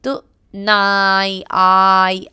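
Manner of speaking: नाई with a loud, long stretched आई; teacher drawing out the long I vowel; long I sound. Shown as a demonstration. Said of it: "आई की long sound".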